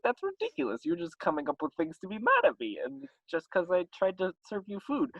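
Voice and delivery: high-pitched comic complaint; mock indignation. Skin suit woman feuding with neighbours, mock indignation. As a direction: argue back in a put-on high voice. Neighbour argues back in feud.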